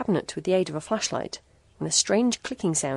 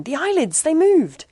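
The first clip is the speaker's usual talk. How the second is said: high, loud panicked scream; terrified scream; 11 semitones higher, 9 dB louder than usual. A narrator's reading. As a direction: shriek it out in terror. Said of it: "screamed June".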